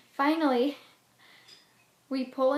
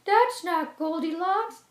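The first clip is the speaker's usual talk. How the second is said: a higher, louder motherly bear; mama bear voice; 6 semitones higher, 6 dB louder, slower than usual. Acted. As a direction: correct him like a fussy mother bear. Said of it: "says the mama bear".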